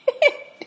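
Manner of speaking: quick high giggle; short high laugh. Real, not acted. Preacher laughing at her own story, a short high laugh. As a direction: burst into a laugh.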